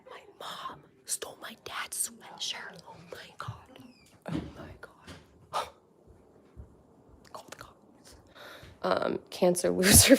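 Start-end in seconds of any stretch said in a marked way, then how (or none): 0.1-8.2 soft mock-alarmed whisper. Real, not acted. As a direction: whisper it like a secret scandal.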